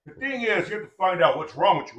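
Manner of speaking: normal talking voice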